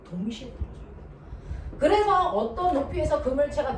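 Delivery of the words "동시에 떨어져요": slow breathy whisper of the conclusion, 동시에 drawn out; hushed, slow whisper for students to imagine it; whispered slowly. Shown as a demonstration. Teacher repeats key conclusion.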